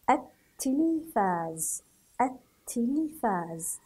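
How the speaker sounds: التلفاز slowly as at-tilfaaz, no l in the article; silent lam. Shown as a demonstration. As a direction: double the t in التلفاز, dropping the article's l. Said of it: "لكن أنا لم أنطقها". (نطق التلفاز ببطء دون لام التعريف)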